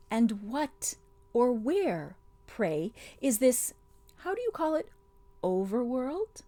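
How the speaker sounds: witch voice. Acted.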